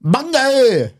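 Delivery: high, bouncy fake Vietnamese accent; bouncy mock Vietnamese accent. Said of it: "You gotta be bouncy".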